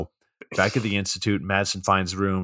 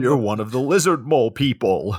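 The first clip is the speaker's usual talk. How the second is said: higher, hammed-up paranoid accusation; conspiracy theorist; 5 semitones higher, 4 dB louder than usual. Co-host joins in accusing wife, mock conspiracy theorist. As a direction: accuse wildly, pitched up. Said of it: "big conspiracy theorist energy".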